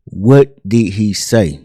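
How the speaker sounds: very slow, word-by-word question; insistent demand. Retold or imitated. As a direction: demand an answer, spacing out each word. Marvin insists on an answer.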